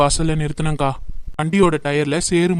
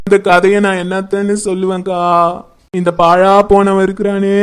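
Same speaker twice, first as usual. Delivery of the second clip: wailing lament; 5 dB louder than usual. Acted. Wife bewails her husband turning on her, wailing. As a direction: wail it like a funeral dirge. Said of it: "அழுவுறதை நிறுத்து".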